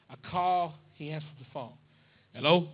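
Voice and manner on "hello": gruff dad. Retold or imitated. Dad answering phone gruffly.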